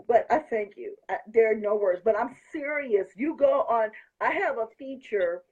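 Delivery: ordinary delivery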